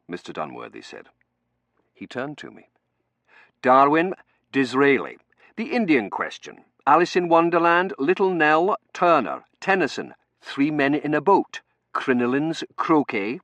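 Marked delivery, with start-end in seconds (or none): 3.6-13.4 loud, raised, item by item; reeling off a list. Acted. Dunworthy prepping the narrator with Victorian topics, loud reeled-off list. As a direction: fire off each item as an emphatic entry.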